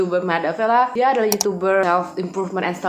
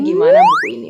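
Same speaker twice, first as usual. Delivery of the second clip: shrill pitched-up squeak; high squeaky question; 9 dB louder, much faster than usual. Real, not acted. High squeal asking about book.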